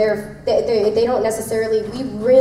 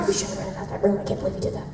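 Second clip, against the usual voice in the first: hushed mock gossip, nearly all breath; retold whispering; 3 semitones lower, 10 dB quieter, much faster than usual. Retelling staff fretting over the rented room, in a whisper. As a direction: whisper it like scandalised onlookers. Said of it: "And they're like".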